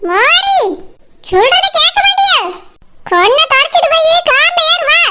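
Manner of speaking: sharply high-pitched maternal reprimand; scolding mother. Acted. Mother to the disobedient bride, scolding. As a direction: tell her off in a high, impatient voice.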